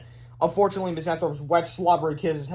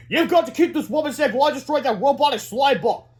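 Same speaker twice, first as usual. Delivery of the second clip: high, loud heroic orders; urgent superhero; 10 semitones higher, 5 dB louder than usual. A narrator's reading. Superhero instructs the boys, urgent and loud. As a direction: give orders in a pitched-up hero voice. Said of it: "said Counter Defense".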